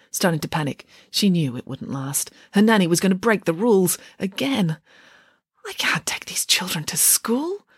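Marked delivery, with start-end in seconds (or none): 5.7-7.7 slow horrified whisper. A narrator's reading. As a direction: breathe it out in dismay.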